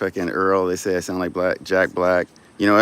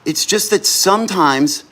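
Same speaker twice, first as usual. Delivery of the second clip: high, keyed-up infomercial pitch; sales pitch; 8 semitones higher, 4 dB louder than usual. Acted. Streamer pitching his gift idea to viewers, hyped salesman voice. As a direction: sell the little dick gift like a TV ad.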